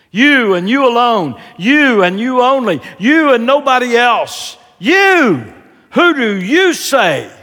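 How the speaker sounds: "You" rung out loud and high; emphatic. Acted. Jesus demanding a personal answer from disciples, forcefully emphatic. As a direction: hammer "You" at full preaching force. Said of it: "That's the way he asked the question".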